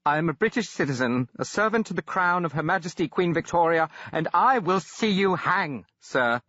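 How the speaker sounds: a proud English subject threatening; English accent. Acted. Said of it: "Sounds English, almost".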